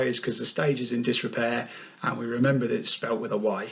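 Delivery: usual speaking voice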